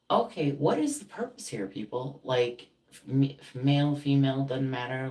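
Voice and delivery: high, loud fed-up demand; exasperated challenge. Retold or imitated. Calling out people who hurt you, exasperated. Imagined confrontation of false friends.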